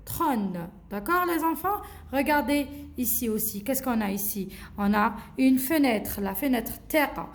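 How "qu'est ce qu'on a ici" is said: normal talking voice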